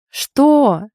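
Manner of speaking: loud, high, startled yelp; astonished cry. A narrator's reading. Friend startled by a crush confession, crying out. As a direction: cry out in disbelief. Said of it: "вскрикнула Вика так, что я даже вздрогнула".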